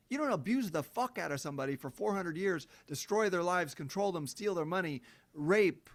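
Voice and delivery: normal talking voice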